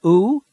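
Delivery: "Oo" as a long rounded oo vowel, as in food; long oo sound. Shown as a demonstration. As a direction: hold "Oo" as a long, tight, rounded oo.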